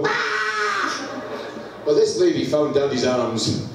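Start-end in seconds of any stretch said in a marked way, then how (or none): 0.0-1.2 very high mock relieved wail; child's wail. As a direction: wail like a child reaching mum. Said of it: "they go".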